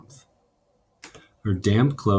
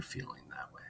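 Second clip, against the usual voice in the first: whisper; 22 dB quieter than usual. Real, not acted. Teacher asks class.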